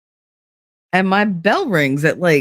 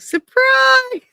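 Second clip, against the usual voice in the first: high, sing-out announcement; mock surprise reveal. Woman revealing her pregnancy to her friend, mock-excited. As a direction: pitch it way up like a playful party reveal.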